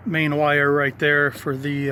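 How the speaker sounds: usual speaking voice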